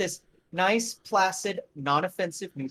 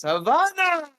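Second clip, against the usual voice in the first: high, loud, thrilled cry; delighted exclamation; 12 semitones higher, 5 dB louder, slower than usual. Acted. Gogol sniffing the cigar and crying out with delight. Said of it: "He goes".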